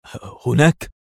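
stammered, nervous question; trembling words. A narrator's reading. Guard looking where his colleague points, trembling. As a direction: let the first word catch and stutter. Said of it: "وارتجفت الكلمات على شفتيه وهو يقول".

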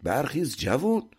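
high, pressing scolding; angry shout. A narrator's reading. Master rouses the sleeping servant at sunset, shouting and cursing. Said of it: "ارباب فریاد کشید".

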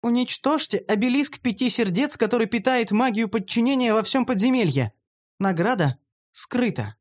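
high, measured female voice; female character reporting. Acted.

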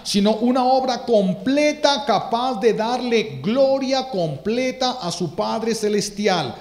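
clímax de sermón, más agudo y fuerte; predicación con fuerza. Acted. (predicación enardecida, más aguda y fuerte)

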